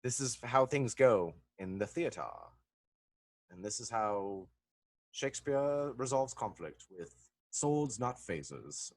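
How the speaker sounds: a hint of lofty stage Shakespearean delivery; Patrick Stewart. Actor lecturing on theater and Shakespeare, lightly hammed-up. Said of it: "like, Patrick Stewart, you should be".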